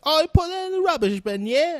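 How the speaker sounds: mocking Americans faking his accent as posh English. Americans faking his accent, mocked as high, loud posh English. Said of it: "they go super English, super posh English".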